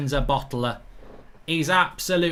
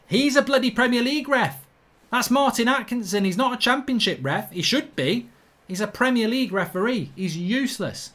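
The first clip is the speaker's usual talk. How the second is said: exasperated rant; 7 semitones higher than usual. Real, not acted. Vlogger ranting to viewers about the useless referee, higher exasperated voice. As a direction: vent your disbelief at the ref.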